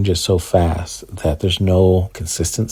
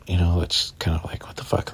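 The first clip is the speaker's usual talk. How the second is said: whispered aside; 8 dB quieter than usual. Real, not acted. Aside about BMW giving nothing.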